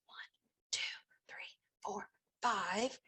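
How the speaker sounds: soft unvoiced counting; whispered counting. Shown as a demonstration. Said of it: "teacher counting cubes demo".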